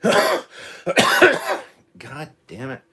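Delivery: loud, high exasperated curse; frustrated outburst. Real, not acted. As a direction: blurt it out in real annoyance. Frustrated outburst after coughing fit.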